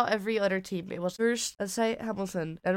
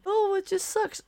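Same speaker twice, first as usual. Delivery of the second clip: much higher, louder whiny mock complaint; Toto Wolff whine; 13 semitones higher, 5 dB louder than usual. Host mocking the team boss complaining about his car, whiny. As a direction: sneer it like a sulky moaner.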